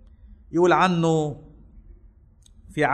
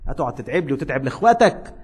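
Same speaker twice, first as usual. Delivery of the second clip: ordinary talk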